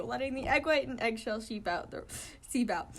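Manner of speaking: plain reading voice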